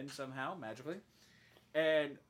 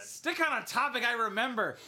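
higher, louder mock complaint; exasperated outburst; 6 semitones higher, 7 dB louder than usual. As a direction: snap it like a fed-up sidekick. Bird's exasperated outburst, imagined.

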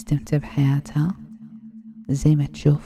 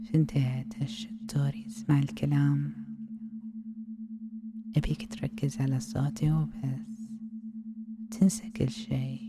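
ASMR whisper. Acted. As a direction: lull him in with a hushed hypnotic whisper.

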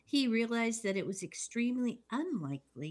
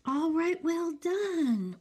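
excited; 8 semitones higher, 7 dB louder, slower than usual. Real, not acted. Cheering the host's intro.

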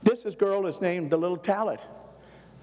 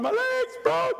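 much higher mock holler; hollering in pain; slower than usual. Mother hopping on one leg, high hollering about her leg.

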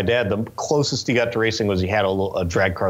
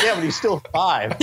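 high, laughing imitation of dad; mock exasperated comeback; retold exasperation; 8 semitones higher than usual. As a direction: argue back as dad through a laugh. Retold dad arguing back, amused.

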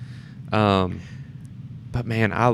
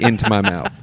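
ordinary talk

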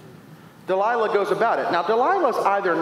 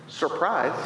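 ordinary delivery

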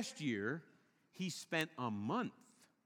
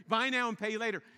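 loud, bright advertising pitch; sales slogan; 5 semitones higher, 5 dB louder than usual. Retold or imitated. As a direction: sell it like a store ad. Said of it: "You heard this, right?".

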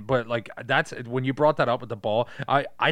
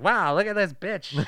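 higher put-on scout voice; Jonathan Lovett impression; 6 semitones higher, 3 dB louder than usual. Scout looking over a woman player, in Jonathan Lovett impression. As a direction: sneer it as a disrespectful talent scout. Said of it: "You do his voice really good".

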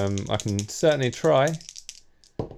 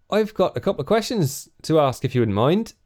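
put-on West Country accent; 5 semitones higher than usual. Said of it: "my West Country accent".

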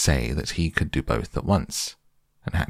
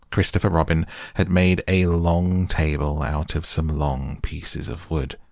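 ordinary delivery

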